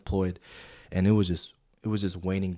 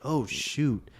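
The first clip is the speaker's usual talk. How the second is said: higher-pitched dawning surprise; sudden realization; 6 semitones higher than usual. Retold or imitated. Young supervisor finally understanding his mentor, sudden realization. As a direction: replay the moment it clicks. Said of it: "I had, like, this epiphany".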